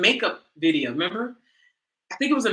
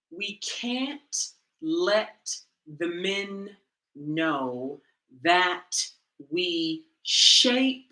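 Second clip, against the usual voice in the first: slow emphasis; 3 semitones lower, much slower than usual. Shown as a demonstration. Repeating the tutorial line slowly so it sinks in. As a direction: let each word land slowly. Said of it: "slow repeat for emphasis".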